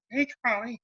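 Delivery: high-pitched cartoonish call; imitating bandmate. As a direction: put on a high voice to hail someone by nickname. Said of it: "he would call you Curly".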